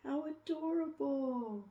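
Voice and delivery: high, gushing squeal of delight; bright gushing exclamation of cuteness. Acted. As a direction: coo over something adorable. Gushing over the tiny flame.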